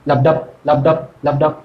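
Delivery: low, separate লাবডাব pulses; heartbeat rhythm. Shown as a demonstration. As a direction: beat out লাবডাব evenly, pausing between. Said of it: "তার মানে বলছি দেখো".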